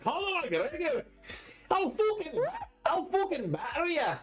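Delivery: high, hammed-up Scottish fighter's threat; hard Scottish accent. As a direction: go overboard on a mock Scottish accent. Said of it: "He, like, went hard Scottish".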